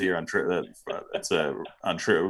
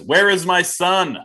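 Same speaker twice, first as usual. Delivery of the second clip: desperate father; 9 semitones higher, 6 dB louder, slower than usual. Retold or imitated. Reading desperate father's review.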